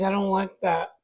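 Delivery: usual speaking voice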